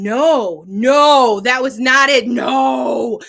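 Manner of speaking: loud emphatic denial. Real, not acted. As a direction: shout no, no.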